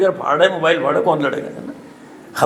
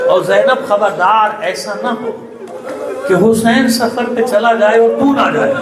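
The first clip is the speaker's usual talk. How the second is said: tearful warning; 3 semitones higher than usual. Acted. Fatima's warning to Zainab.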